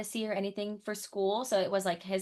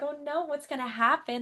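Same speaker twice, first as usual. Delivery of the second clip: higher-pitched mock dread; retold alarm; 6 semitones higher than usual. Retelling a scary film moment to a friend, mock scared. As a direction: recount being scared, pitched up. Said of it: "I got so scared".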